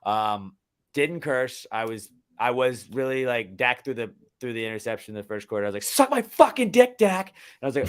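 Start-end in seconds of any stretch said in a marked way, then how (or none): 5.8-7.3 high, loud, drawn-out cursing at a player; heated fan outburst; angry retold yell. Retold yelling at the game.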